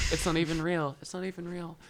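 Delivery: high, choked-up self-reassurance; tearful. Real, not acted. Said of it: "why am I crying?".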